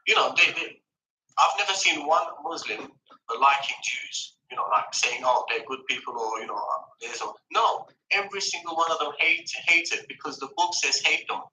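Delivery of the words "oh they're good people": usual speaking voice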